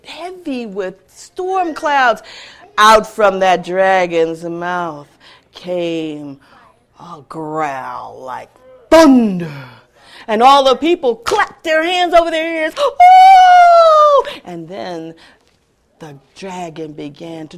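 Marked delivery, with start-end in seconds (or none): 2.8-9.7 lower, slow rumbling narration; ominous telling. A narrator's reading. Storyteller building the dragon's growl, ominous. 12.8-14.3 very high loud cry of alarm; startled shriek. Acted. Crowd shrieking at the thunder, startled. People covering ears.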